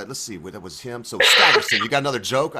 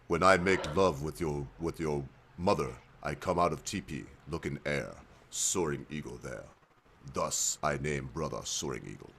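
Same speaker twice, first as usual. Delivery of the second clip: put-on old chief father; 9 semitones lower, 10 dB quieter than usual. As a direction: drop the voice way down and speak like a grave old chief. Father answers son in joke.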